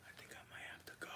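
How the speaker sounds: quick resigned whisper. Real, not acted. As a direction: mutter it fast under breath.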